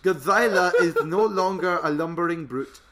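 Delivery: loud, drawn-out dramatic card reading; hammed-up trailer voice. Host reads a monster trading card to co-host, hammed-up trailer voice. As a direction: boom it out like an over-the-top film trailer.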